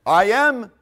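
loud, high, ringing declaration; Jesus declaring. Acted. Jesus answering the high priest, bold declaration. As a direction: answer boldly, voice lifted high. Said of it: "What did Jesus said?".

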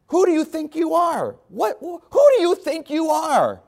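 high, sneering taunt from the giant; mocking giant. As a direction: jeer it down from way up high like a bully. Preacher voicing giant's taunt.

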